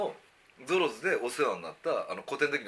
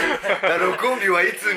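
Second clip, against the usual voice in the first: mock listener voice; 6 semitones higher, 11 dB louder, much slower than usual. Acting out a curious listener.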